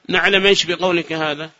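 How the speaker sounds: ordinary talk, nothing marked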